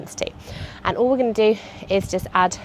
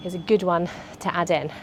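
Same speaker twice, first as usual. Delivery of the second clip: ordinary talk, nothing marked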